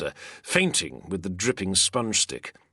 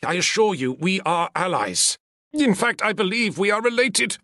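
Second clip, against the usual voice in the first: pleading; 11 semitones higher, 4 dB louder than usual. Acted. Senator pleads alliance.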